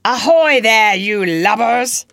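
loud, high pirate-captain hail; Hook imitation. A narrator's reading. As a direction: bellow across the water as a captain. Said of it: "he now imitated the voice of Hook".

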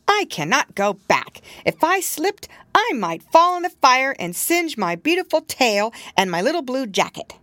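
high, anxious little kitten voice. Acted. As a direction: play a small scared kitten. Tom Kitten speaks in story.

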